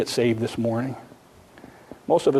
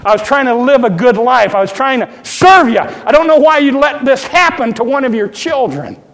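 loud, high, aggrieved complaint to God; mad at God; 9 semitones higher, 8 dB louder than usual. Retold or imitated. Believer complaining to God after loss, loud hurt protest. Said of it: "we want to get mad at God".

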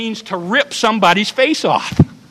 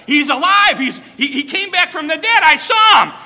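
breathless, higher shout of joy; excited; 6 semitones higher, 6 dB louder than usual. Acted. Mary telling the disciples he lives, excited shout. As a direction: burst out with the news. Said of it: "And she got excited".

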